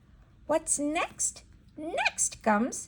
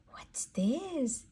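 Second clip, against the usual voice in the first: whisper; 3 semitones higher, 7 dB quieter than usual. Acted. Whispered question to child.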